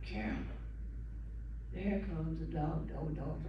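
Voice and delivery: low, hushed warning; hushed fearful. Retold or imitated. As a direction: whisper a warning in dread. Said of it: "and I said".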